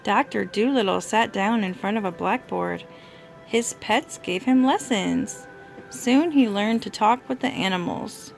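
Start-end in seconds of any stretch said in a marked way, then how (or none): none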